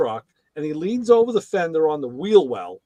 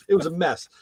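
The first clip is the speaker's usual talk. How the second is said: normal talking voice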